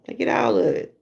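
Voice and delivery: normal talking voice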